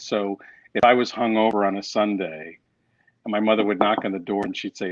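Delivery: ordinary talk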